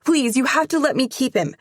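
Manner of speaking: fast, high, loud begging; tumbling plea to keep the cat; rushed pleading. Acted. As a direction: rush the words out to her mom. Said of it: "talking so fast my tongue trips over the words".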